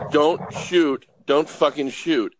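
loud panicked yell at the cops; yelled out the window. Retold or imitated. Yelling from the car at armed police, loud and panicked. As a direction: shout it so the cops hear, don't shoot. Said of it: "I yelled out the window".

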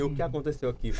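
bem mais baixo e grave, firme; coordenadora brava. Retold or imitated. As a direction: imita a coordenadora séria. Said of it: "Ela entrou assim". (coordenadora imitada, voz baixa e grave)

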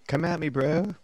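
brash, daring challenge; cocky taunt. Acted. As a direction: dare the zombie to swing. Player taunts an attacking zombie.

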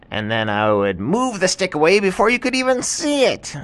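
monkey explaining its trick; gleeful monkey. Acted. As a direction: gloat high through a giggle. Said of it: "the monkey started to laugh".

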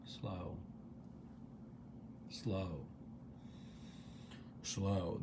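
soft, low, spaced-out mantra; hushed meditative repetition; intention repeated. Shown as a demonstration. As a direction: breathe each word out gently, leaving space. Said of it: "say your intention out loud".

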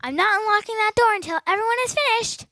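angry refusal. Acted. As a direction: lock them in with your voice. Monster teacher refuses to unlock.

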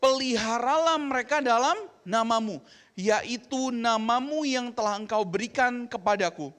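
slow, loud congregational reading; solemn unison Bible reading; scripture reading aloud. Acted. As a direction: read the verse together in unison. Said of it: "Kita baca sama-sama".

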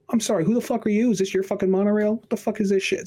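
high, fast, outraged comeback; mock indignant retort. Snapping back at the old guy, high and fast.

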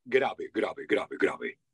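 deep Panamanian-style imitation; very deep delivery. Shown as a demonstration. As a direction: drop to the bottom of your register. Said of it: "Panamá tiene un acento muy grave".

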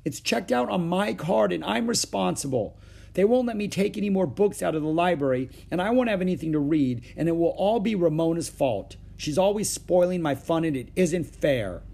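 put-on high-pitched, aggrieved child; whiny protest of unfairness; upset complaint. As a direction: pour out the injustice to Mother.

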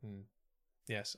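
usual speaking voice

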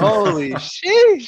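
high, loud, very slow sheesh dragged out; drawn-out Holy sheesh; stretched hype. Real, not acted. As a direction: savour the word like you can't believe it. Hyping up a good transition.

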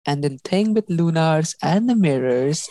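ordinary talk

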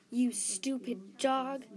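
slow, loud, drawn-out insult; angry yell. A narrator's reading. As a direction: yell it slowly at the dog. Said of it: "Snow yelled".

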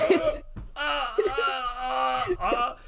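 high, hammed-up wailing cries; anguished wail. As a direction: cry out like a man struck to the heart, mock pain. Reacting to Gary's hard truth.

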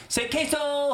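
high, bright call of queso; playful photo prompt; mock photographer. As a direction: sing out like a cheesy photographer.

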